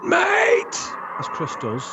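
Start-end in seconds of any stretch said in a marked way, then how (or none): 0.0-0.7 sudden very high yelled Mate; loud shout. Retold or imitated. Friend yelling at the driver in the van, a piercing shout. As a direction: bellow it out in alarm. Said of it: "shouts out really loud".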